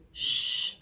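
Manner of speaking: soft voiceless shush; hushing. A narrator's reading. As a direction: hush someone quickly. Moo hushes Minnie.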